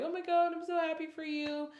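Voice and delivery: high, gushing imitation of a happy dad; thrilled congratulations, retold; delighted parent. Dad's happy reaction acted out.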